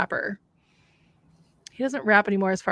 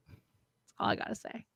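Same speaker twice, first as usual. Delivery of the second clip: ordinary delivery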